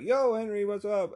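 high-pitched mock-excited greeting; enthused. Customers greeting the night-shift clerk back, enthused. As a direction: call back like an eager bro.

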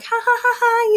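high, giggly imitated girls' laughter; mock giggling.

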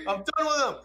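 high-pitched mock fed-up outburst; pissed-off girl.

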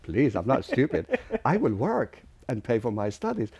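loud, insistent plea to a parent; retold protest. Acted protest to angry father.

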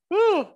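high, blown-out breath of amazement; exhale whew. Real, not acted. Host blown away by the poem, high whew. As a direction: let the air out, floored.

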